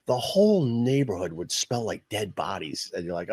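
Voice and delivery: normal talking voice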